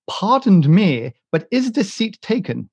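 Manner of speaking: high-pitched mimicked American lady; American accent. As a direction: imitate an American woman politely asking, pitched high. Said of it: "But in an American accent".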